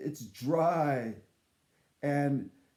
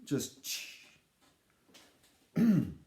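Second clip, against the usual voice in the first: ordinary talk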